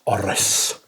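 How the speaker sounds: a sudden hushed "Orris." to oneself; odd whispered echo. Real, not acted. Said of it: "Don't know why I said it like that".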